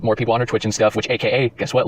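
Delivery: ordinary talk, nothing marked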